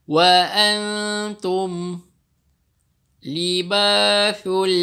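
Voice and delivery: slow, drawn-out chanted recitation; Quran recitation. Shown as a demonstration. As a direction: recite it slowly in tajwid style. Recites verse twice.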